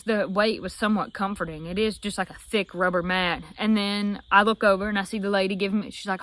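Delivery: usual speaking voice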